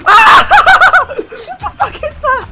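high scream then laughing; being chased. Real, not acted. Monster suddenly appears, screaming.

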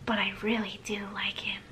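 whispered aside. Shown as a demonstration. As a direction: whisper it like a secret. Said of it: "In parentheses".

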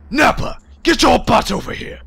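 loud, high barking Vegeta shout. Retold or imitated. Gamer yells for Nappa as a bossy Vegeta. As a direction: yell for Nappa like a bossy anime prince. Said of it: "I can't do Vegeta".

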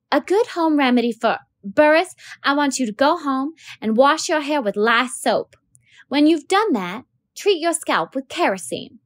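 teacher asking a dirty pupil to spell his name; flustered teacher. Acted. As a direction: coax the boy politely, a little flustered.

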